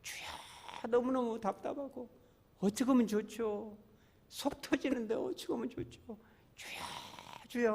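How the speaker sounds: soft, high lament to God; frustrated pleading prayer. Acted. Pastor models praying when bursting with frustration, hushed high plea. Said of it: "속 터질 때".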